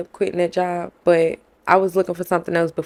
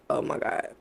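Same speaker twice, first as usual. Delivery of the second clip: whispered in dread; hushed panicked whisper; 6 semitones lower, 8 dB quieter than usual. Retold or imitated. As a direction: breathe it out in sudden fear. Dread at background check news.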